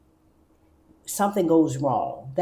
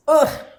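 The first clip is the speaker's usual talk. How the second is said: disgusted ugh; 10 semitones higher, 9 dB louder than usual. Real, not acted. Groaning over drinking.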